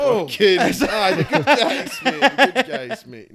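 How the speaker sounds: a mocking cheer for the good guys; laughing sarcasm. Real, not acted. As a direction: sneer it through a laugh, slowly. Sarcastic praise of allies.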